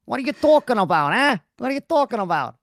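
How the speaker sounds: punchy put-on American tough talk; Stephen Graham. Husband demonstrating a simple American accent to his wife, loud mock American. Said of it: "Stephen Graham doesn't go".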